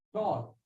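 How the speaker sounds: "tall" said softly as one unbroken sound; one syllable. Shown as a demonstration. Teacher showing what one sound means, one syllable. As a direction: say "tall" in a single beat. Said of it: "the word is only one sound".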